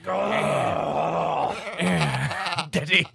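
short grunts, then a call for Daddy; fussing toddler impression; imitating a toddler. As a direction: mimic a baby grunting in the crib. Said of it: "that's kinda how it sounded".

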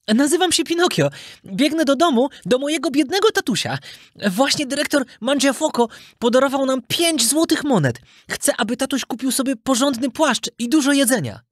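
put-on high, eager child voice; chatty puppet boy. Puppet boy telling fox his errand, high and eager. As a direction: tell it innocently in a small high voice.

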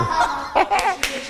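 sudden high laugh; high squealing laugh. Real, not acted. Breaking character, high laugh. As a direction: crack up.

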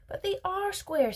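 high-pitched protest. A narrator's reading. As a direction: argue back, pitch shooting up. Wonka argues back.

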